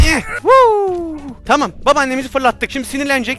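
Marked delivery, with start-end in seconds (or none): 0.1-1.2 high loud woo; excited whoop. Real, not acted. Player cheering while throwing granny, loud whoop. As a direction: cheer as she flies.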